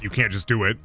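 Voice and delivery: ordinary talk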